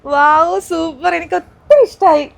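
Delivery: loud, thrilled wow; delighted gasp. Real, not acted. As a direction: burst out with real delight. Opening surprise room door. (ആവേശത്തോടെ ഉറക്കെ)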